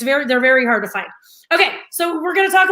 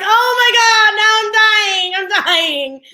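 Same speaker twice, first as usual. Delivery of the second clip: loud, high squeal of delight; swooning, over-the-top delight; retold gushing; 7 semitones higher, 5 dB louder than usual. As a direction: gush over puppies, pitched way up.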